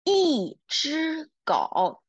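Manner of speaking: usual speaking voice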